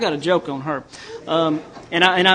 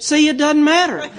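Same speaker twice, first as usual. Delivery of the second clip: higher, louder, a know-it-all punchline; knowing older woman; 9 semitones higher, 4 dB louder than usual. Retold or imitated. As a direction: deliver her putdown with satisfaction. Voices Kitty Lou's punchline.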